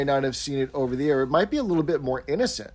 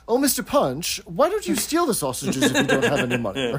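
higher, lightly posh gent; a hint of a haughty floorwalker; 6 semitones higher than usual. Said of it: "in Captain Peacock's posh voice".

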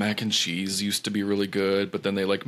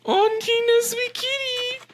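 very high, slow cooing squeal; slower than usual. Real, not acted. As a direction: squeal sweetly at the kitty. Cooing to the cat on camera.

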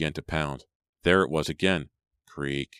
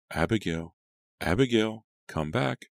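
soft calling; 5 semitones higher than usual. A narrator's reading. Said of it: "called out softly".

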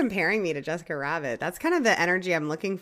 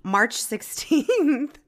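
slow, high, drawn-out date; teasing; 6 semitones higher, much slower than usual. Real, not acted.

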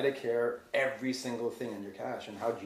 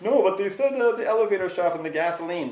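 much higher, louder whiny protest; mocking doubter; 8 semitones higher, 10 dB louder than usual. Mocking 9/11 skeptics to his cohost, high loud whine. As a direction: squeal it like a scoffing skeptic. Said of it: "people are like".

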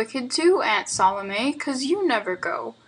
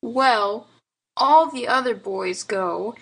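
put-on small boy standing his ground; defiant child; 3 semitones higher, slower than usual. A narrator's reading. Boy argues with aunt for Sunday school, defiant. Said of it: "said Lionel Hezekiah defiantly".

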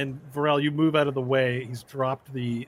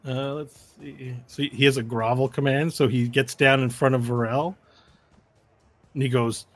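ordinary talk

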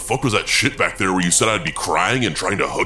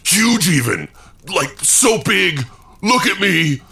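puffed-up boast; 7 semitones higher, slower than usual. Acted. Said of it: "He's trying to puff himself up to be bigger".

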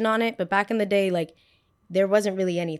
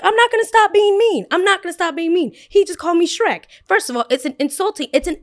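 high, loud, furious; refusing to stop being mean to friends; defiant yell; 10 semitones higher, 7 dB louder than usual. Retold or imitated. High, loud, defiant. Yelling back at girls.

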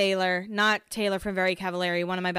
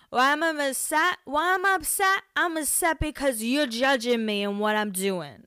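put-on wasted drunk self; 4 semitones higher than usual. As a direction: slur it like you're wasted and upset. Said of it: "That's exactly how, like, wasted, wasted, wasted me talks".

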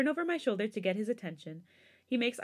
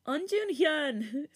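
put-on high, very slow little-boy call; toddler voice; 7 semitones higher, much slower than usual. A narrator's reading. Little brother copying his sister, high toddler call. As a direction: stretch it out like a small boy. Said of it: "Jae-sung repeats".

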